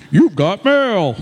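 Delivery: mock computer voice saying you've got mail; dial-up computer voice. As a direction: mimic the old AOL mail alert. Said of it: "I'm still on dial-up".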